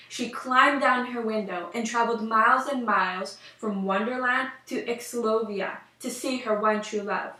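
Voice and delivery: ordinary delivery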